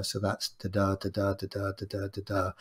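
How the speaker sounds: da da tapped out softly, da unstressed then da stressed, in pairs; iambic rhythm demo. Shown as a demonstration. Teacher demonstrating iambic pentameter to students, softly beating da da. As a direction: beat out the iambic da da pattern quietly. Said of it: "unstressed, stressed syllables".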